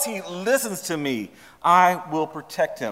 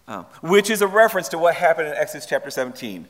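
ordinary talk

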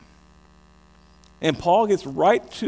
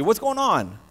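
ordinary delivery

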